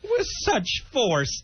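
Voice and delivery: slow, high-pitched mock drama; hammed-up, drawn-out mimicry; mocking echo. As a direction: draw it out high to ridicule his words. Mockingly echoes Billy's words.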